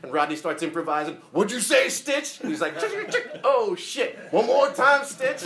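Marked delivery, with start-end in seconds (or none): 1.3-5.4 high, loud playful calls; much higher, loud frontman banter; improvised stage banter. Retold or imitated. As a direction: riff back at the DJ mid-song. Rodney improvising with DJ.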